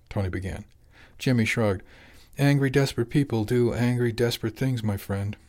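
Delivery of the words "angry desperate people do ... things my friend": plain reading voice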